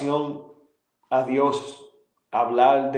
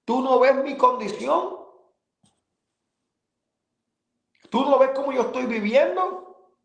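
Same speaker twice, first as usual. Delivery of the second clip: high, pleading questions thrown upward; retold desperate plea; 9 semitones higher than usual. Alone in the house, confronting God, desperate. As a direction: demand that God look at him. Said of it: "le confesaba a él gritando".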